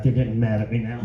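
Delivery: ordinary talk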